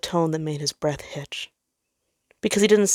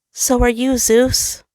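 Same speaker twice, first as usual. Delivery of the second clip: sun's voice; 8 semitones higher, 5 dB louder, slower than usual. Acted. Sun replies to Jupiter.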